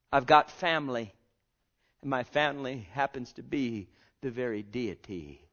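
ordinary delivery

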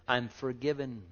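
ordinary delivery

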